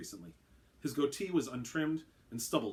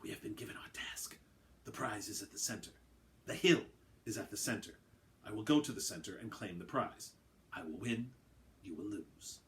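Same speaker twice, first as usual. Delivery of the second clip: soft hissing whisper; 3 semitones lower, 5 dB quieter than usual. Acted. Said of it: "In a hiss-like whisper".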